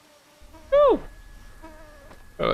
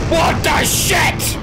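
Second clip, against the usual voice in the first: high, slow frightened shout; startled shout; 4 dB louder, much slower than usual. Real, not acted. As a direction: scream it in real fright. Jump scare in game.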